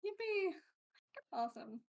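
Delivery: ordinary delivery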